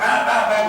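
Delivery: loud, barked "Ah, ah, ah"; imitated dog bark.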